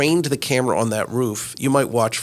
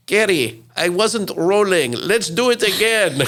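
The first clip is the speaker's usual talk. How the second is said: mock Russian accent, pitched up, a touch slower, laughing at the end; a hint of a Russian cameraman, high and amused; 9 semitones higher, 4 dB louder than usual. Recalling Russian cameraman asking for retake, lightly mock Russian accent. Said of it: "I can't really do his accent".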